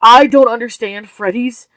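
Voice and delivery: husband hitting back at his wife, loud; retorting. Acted. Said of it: "he retorted".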